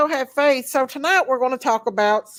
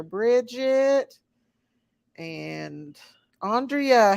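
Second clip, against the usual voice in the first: ordinary delivery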